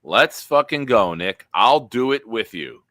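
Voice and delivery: loud, excited cheer; pumped-up loud celebration. Real, not acted. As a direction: whoop it up for Nick. Celebrating on stream.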